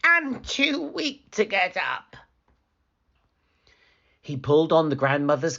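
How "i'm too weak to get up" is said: wolf faking grandmother; wolf as grandmother calling. Acted. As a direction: call out as the fake granny. Wolf faking frail granny.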